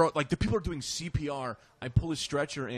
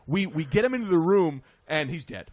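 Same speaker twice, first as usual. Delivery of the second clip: normal talking voice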